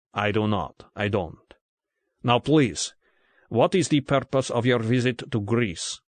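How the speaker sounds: tired, frightened Russian interrogator; measured questions in a heavy Russian accent; thick Russian accent. A narrator's reading. Gordienko questioning Bond, thick Russian accent. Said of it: "his accent no less thick in English than in Greek".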